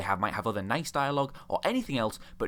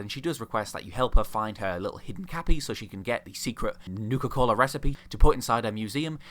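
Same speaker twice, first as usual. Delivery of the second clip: ordinary talk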